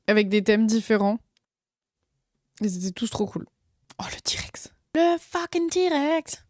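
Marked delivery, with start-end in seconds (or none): 4.0-4.7 breathless whisper of delight; whispered awe. Acted. Streamer whispering over the T-Rex sticker. 4.9-6.4 high, slow, drawn-out excitement; hyped. Acted. Streamer exploding with joy at the T-Rex sticker.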